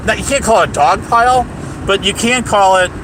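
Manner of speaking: normal talking voice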